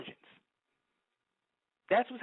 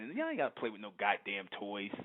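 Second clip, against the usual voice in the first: normal talking voice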